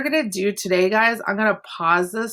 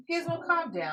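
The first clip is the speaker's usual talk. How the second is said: higher, softer pet-soothing voice; a light pet voice to calm Gizmo; talking to the dog; 10 semitones higher, 9 dB quieter than usual. Real, not acted. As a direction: coo the dog to settle him down.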